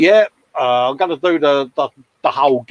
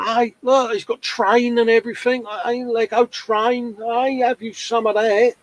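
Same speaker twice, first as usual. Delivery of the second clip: high, bright with delight; excited delight; 9 semitones higher than usual. Real, not acted. Admiring kids' Lego builds.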